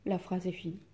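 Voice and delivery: usual speaking voice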